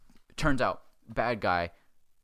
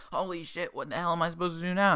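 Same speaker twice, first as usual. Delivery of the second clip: high-pitched, hammed-up dismay; mock panic; 8 semitones higher than usual. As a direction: play it as a high, panicky protest. Acted friend panics at the news.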